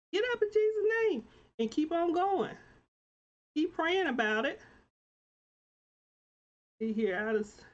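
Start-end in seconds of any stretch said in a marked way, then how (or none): 0.1-4.6 high, rousing push in Jesus' name; fervent exhortation. Real, not acted. Host urging viewers to keep going, fervent. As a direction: preach it to lift them up.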